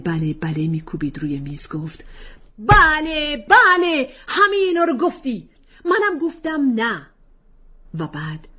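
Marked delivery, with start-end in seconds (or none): 2.6-7.1 much higher, louder sarcastic bluster, last words slow; mock her with each beat; mocking yes-yes. Husband drumming table mocking wife's claims, sarcastic.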